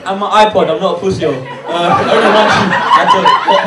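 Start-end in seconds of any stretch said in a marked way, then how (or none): 2.7-3.6 squeaky high-pitched jump on the words. Real, not acted. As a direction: let the voice shoot way up, rattled. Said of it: "my voice went up three octaves".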